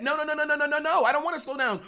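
loud, high mock protest; upset protest. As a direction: push back like a kid told to stop. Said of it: "I got a bit upset".

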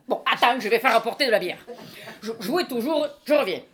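calling loudly over the room, high; booming host bluster. Acted. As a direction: shout the order off toward the kitchen. Host calls loudly for beer.

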